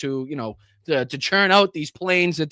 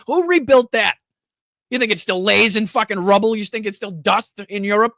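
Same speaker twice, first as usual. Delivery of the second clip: furious rant; 4 semitones higher than usual. Real, not acted. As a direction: yell it out, disgusted and livid.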